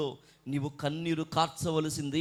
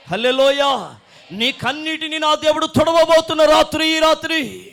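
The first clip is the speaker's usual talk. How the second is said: loud prophetic promise, swelling to a climax; full-force preaching; 2 semitones lower, 14 dB louder than usual. Real, not acted. Preacher promises the congregation God will wipe their tears, building to a shout.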